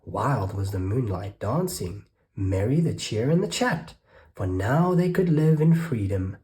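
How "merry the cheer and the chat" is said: usual speaking voice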